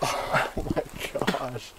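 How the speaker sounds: breathless whispered gasp; barely voiced, stunned. Real, not acted. Reacting to painful shot.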